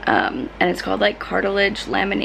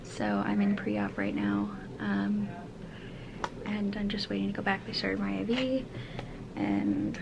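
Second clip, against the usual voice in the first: quiet; 3 semitones higher, 9 dB quieter than usual. Real, not acted. Waiting quietly in pre-op.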